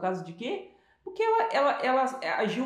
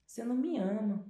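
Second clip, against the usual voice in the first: pouty self-pity; 3 semitones higher than usual. Retold or imitated. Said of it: "aquela coisa assim".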